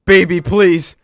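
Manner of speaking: over-the-top shouted devotion, building to a proposal; frantic mock begging. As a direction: beg wildly like a rejected suitor. Reading out desperate pleading texts.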